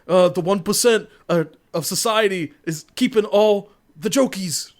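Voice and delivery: lightly hammed-up Bernie rant; half-hearted Bernie stump-speech voice; a touch of Bernie; mock Bernie Sanders. Bernie as Joker quoted.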